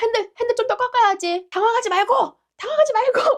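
high, loud, urgent encouragement; acted rallying shouts; imitated cheering coach. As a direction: coach a panicking driver at high pitch. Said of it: "갑자기 응원을 해주시는 거야".

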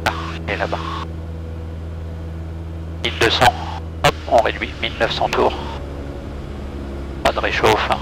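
ordinary delivery

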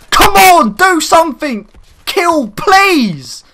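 loud, high, pleading yell; real mad shouting. Real, not acted. Gamer desperate for a kill, getting really mad. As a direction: shout at the game, begging and furious. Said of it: "I'm actually getting so mad right now".

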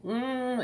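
high-pitched nasal "mm"; high, droning nasal hum. Shown as a demonstration. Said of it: "all nasal".

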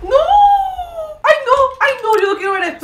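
loud, high horrified outcry; real shocked wail; horrified cry. Real, not acted. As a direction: cry out in dismay at what she sees. Reacting in horror to video.

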